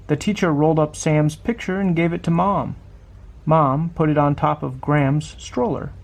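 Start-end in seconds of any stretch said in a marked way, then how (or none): none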